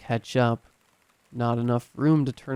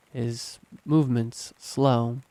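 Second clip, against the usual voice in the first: ordinary delivery, nothing marked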